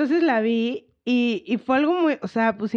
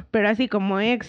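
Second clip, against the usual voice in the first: normal talking voice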